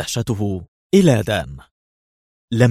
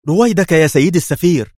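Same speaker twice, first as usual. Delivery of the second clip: much higher, louder, alarmed; alarmed cry; 8 semitones higher, 7 dB louder than usual. A narrator's reading. The aide begs the ambassador to calm down, alarmed. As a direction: cry out in a panic. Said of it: "هتف دان في جزع".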